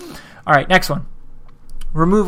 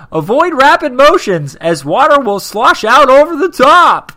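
mocking read; 8 semitones higher, 9 dB louder than usual. Mock reading of cautious advice.

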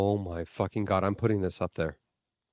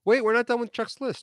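protesting; 12 semitones higher than usual. Real, not acted. Protesting that the list was cut short.

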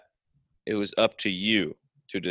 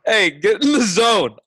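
slogan voice; 10 semitones higher, 6 dB louder than usual. Acted. Jokey slogan line.

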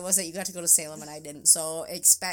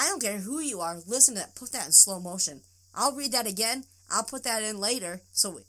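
normal talking voice

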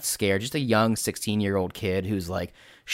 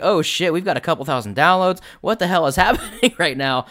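high, loud and amazed; stunned delighted outburst; excited realization; 8 semitones higher, 5 dB louder than usual. Retold or imitated. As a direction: blurt it out in delighted shock. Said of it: "I'm like".

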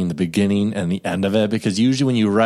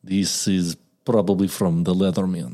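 put-on Russian accent; 2 semitones lower, 4 dB quieter than usual. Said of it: "can you do a Russian accent?".

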